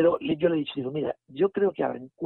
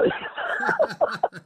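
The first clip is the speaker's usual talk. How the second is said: a squealing mock laugh, much higher; imitated high laugh; 3 dB louder than usual. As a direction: mimic her laughing through tears. Patient's laugh acted out.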